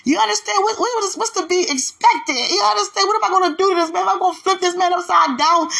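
asking God in giddy amazement; excited disbelief. Retold or imitated. Woman asking God what her roar will do, excited and high. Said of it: "I'm like".

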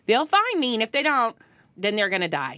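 loud, high mom yelling across the store; mock carefree mom shout; mom's shout. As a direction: yell it like a mother who doesn't care. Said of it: "That's how my mom would say it".

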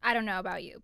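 usual speaking voice

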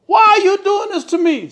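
high, loud wailing question; anguished protest. A narrator's reading. Beaten husband pleads with his wife, anguished protest. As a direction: cry out in protest.

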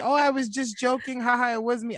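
very high, mocking excuse voice; imitating a joking friend. Voicing friend's excuse after the scare.

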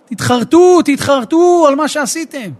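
a rebbe crying out to his followers, slow on the second line; preacher imitating a weeping rebbe's loud plea; tearful outcry. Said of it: "התחיל לבכות".

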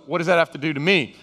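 ordinary delivery

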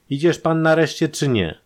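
loud, high, impatient question; impatient demand. A narrator's reading. Captor loses patience with prisoner.

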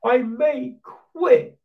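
high, slow melodramatic sulk; mock Hamilton whining. Mocking Hamilton threatening to quit, high whiny sulk. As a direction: play the wounded star, hammed-up. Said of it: "now it's just like".

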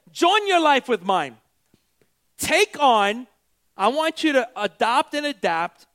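emphatic, driving preacher; full-force preaching. Real, not acted. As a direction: preach it out with punched key words.

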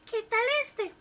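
high eager wife asking; wife pointing out the motel; wife's voice. A narrator's reading. Wife asks about motel.

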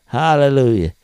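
ordinary talk, nothing marked

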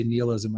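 "nihilism" said as NEE-uh-lizm; French-influenced pronunciation. Shown as a demonstration. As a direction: open "nihilism" on "nee", as in France. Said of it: "in France we say".

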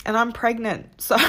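breaking into a high laugh; hormonal and emotional. Real, not acted. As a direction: laugh it off while tearful. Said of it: "so pregnancy hormones".

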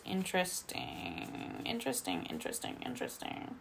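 fading to a whisper; hushed musing. Real, not acted. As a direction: murmur it, trailing off to breath. Musing over a tarot card.

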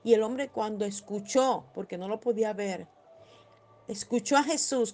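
usual speaking voice

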